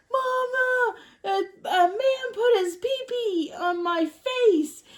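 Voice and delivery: high, whiny mock kid voice; high-pitched, drawn-out child's complaint; tattling little boy. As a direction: imitate a kid wailing to mom.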